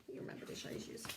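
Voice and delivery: soft praising whisper. Acted. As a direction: whisper it like a secret well done. Praising children softly.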